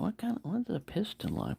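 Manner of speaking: normal talking voice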